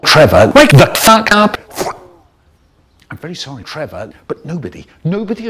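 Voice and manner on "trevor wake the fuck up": loud angry yell; shouting. Acted. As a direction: bark it right at him. Yelling at dazed Trevor.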